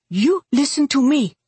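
high, heated command; angry demand. A narrator's reading. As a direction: force him to listen, pitched high. Dolly angrily confronts Hugh.

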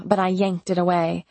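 ordinary delivery, nothing marked

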